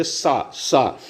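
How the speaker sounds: सा with a whistling hiss, air rubbing out. Shown as a demonstration. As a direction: whistle through सा softly. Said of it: "सीटी type का जो है आवाज़".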